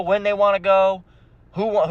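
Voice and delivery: ordinary talk, nothing marked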